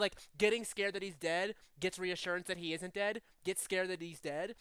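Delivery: usual speaking voice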